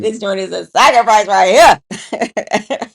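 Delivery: loud, laughing mock kid complaint; imitating a kid groaning through laughter; laughing kid quote. Mother imitates her kids complaining, laughing loudly. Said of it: "kids be like".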